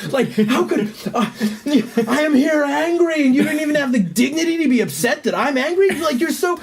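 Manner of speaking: high, strained, incredulous tirade; retold angry outburst. Son berating his oblivious father, angry and high-pitched. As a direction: pour out years of anger in disbelief. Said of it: "my anger was so just me and upset".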